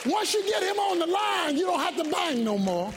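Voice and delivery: high, driving sermon delivery; preaching at full force. Real, not acted. Preacher telling congregation about connection with God, full-force preaching.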